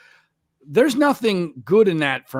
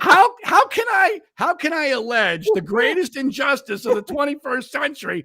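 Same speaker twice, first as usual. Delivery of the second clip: high-pitched, exasperated outcry; indignant protest; 10 semitones higher, 3 dB louder than usual. Acted. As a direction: protest in hammed-up disbelief, pitched way up. Acts out protest to his father.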